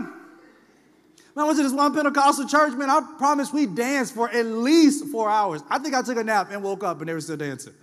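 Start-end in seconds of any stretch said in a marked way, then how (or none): none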